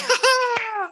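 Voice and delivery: high mocking little laugh; teasing laugh. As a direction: laugh at them, high and playful. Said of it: "She's like".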